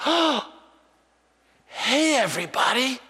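put-on gasp, then high childlike call; excited little boy. Grandson spotting grandpa among his buddies, high excited child voice. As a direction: imitate a small child thrilled to spot someone.

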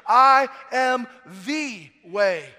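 loud, very slow, every word held; booming proclamation. Acted. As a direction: proclaim it to the whole church. Slow booming scripture quote.

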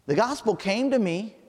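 high, loud, urgent preaching; rising fervent sermon cry; preaching at full force. Acted. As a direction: hammer home every real. Preaching climax.

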